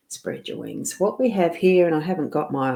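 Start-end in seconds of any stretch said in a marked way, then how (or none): 0.1-1.0 soft breathy whisper, lower. Shown as a demonstration. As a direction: whisper it to yourself. Repeats card message as whisper.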